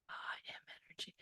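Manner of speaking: fast, breathless whisper of the words; whispered affirmation. Shown as a demonstration. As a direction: whisper it as a secret declaration. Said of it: "you have to do the I am of it".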